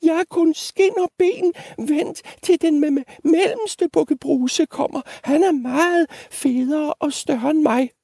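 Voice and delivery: very high, stammering plea; pleading little goat. Acted. Littlest goat bargaining with the troll, tiny pleading squeak. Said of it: "bad den lille bukke Bruse".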